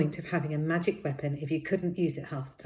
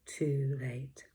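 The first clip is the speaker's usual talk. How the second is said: ordinary delivery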